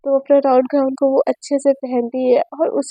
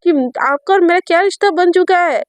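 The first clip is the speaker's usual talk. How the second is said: higher, mock-offended girlfriend; Sejal's voice; 4 semitones higher than usual. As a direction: complain in a higher, hurt, teasing tone. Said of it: "तो सेजल बोलती है".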